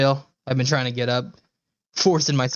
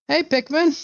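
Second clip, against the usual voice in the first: cute greeting; 4 dB louder than usual. Real, not acted. Greeting a pet, high voice.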